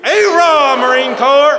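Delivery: full-force, high Marine battle cry; loud boisterous cheer. Shown as a demonstration. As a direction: shout it out long and high for the whole room. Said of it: "in a very loud and boisterous manner".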